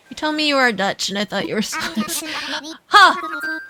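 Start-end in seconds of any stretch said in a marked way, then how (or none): none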